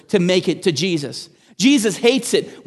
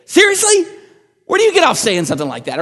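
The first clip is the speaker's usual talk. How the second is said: much higher and louder, acting out outrage; mock indignant outburst; 11 semitones higher, 5 dB louder, faster than usual. Pastor acting out an indignant retort to a rude remark, high and loud. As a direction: fire back in exaggerated disbelief, fast at the end. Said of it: "you're like".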